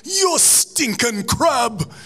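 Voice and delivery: high, slow Jamaican insult; drawn-out Jamaican jeer at a crab; Jamaican accent. Acted. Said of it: "Hermes versus Zoidberg".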